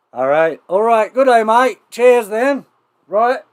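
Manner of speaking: loud, high Australian greeting; broad mock-Australian, punched out; put-on Australian accent. Host trying on the skit's Australian accent, loud and high. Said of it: "Just as I get the accent".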